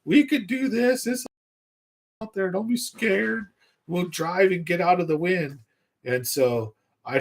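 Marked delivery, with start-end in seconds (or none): 0.0-5.6 high coaxing put-on voice; a soothing little voice saying go on; inner voices. Voices in his head urging him out, high coaxing tone. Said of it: "they're talking like".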